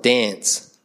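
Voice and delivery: ocker "dance" with a short flattened a, as in "cat"; mock broad Australian vowel in "dance"; ocker Australian accent. Shown as a demonstration. Preacher showing what Adelaide avoids, ocker accent on "dance". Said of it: "We're not ochre".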